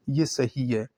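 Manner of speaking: normal talking voice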